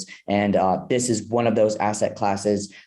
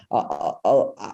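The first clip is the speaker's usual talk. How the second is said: usual speaking voice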